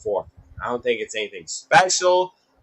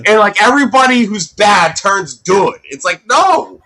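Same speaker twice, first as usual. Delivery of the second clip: exasperated rant; 7 semitones higher, 6 dB louder than usual. Real, not acted. Ranting about absurd movie plots.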